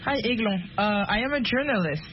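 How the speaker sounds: ordinary talk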